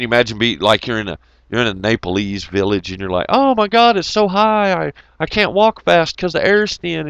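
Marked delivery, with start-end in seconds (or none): 3.3-7.1 mock whiny climber. Imagined climber complaining.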